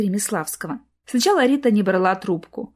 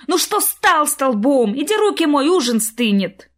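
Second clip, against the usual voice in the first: scolding; 6 semitones higher, 4 dB louder than usual. Acted. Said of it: "набросилась на мужа".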